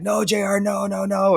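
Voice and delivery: high-pitched, imitated frantic pleading; mock urgent protest. Friends trying to stop him leaving the SUV, imitated urgent protest. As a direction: call it out high, like friends holding someone back.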